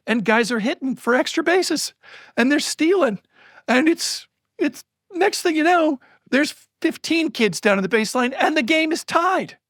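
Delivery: high-pitched, breathless play-by-play build; thrilled recounting, pitched high; excited storytelling. Retold or imitated. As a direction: let the rally carry your voice up and keep it there. Retelling a rally building to tie.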